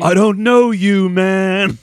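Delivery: slow movie line impression.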